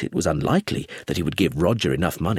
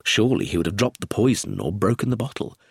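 usual speaking voice

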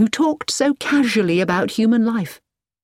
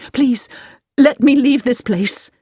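higher, louder desperate begging; desperate plea; 7 semitones higher, 5 dB louder than usual. Acted. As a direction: beg him to let her go. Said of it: "said Hilary desperately".

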